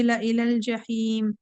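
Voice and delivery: slow, drawn-out tajweed recitation; Quran recitation. Shown as a demonstration. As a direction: chant the verse slowly with care. Teacher recites Quran verse. (धीमी तजवीद वाली तिलावत)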